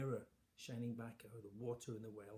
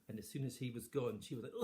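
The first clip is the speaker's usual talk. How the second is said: ordinary delivery, nothing marked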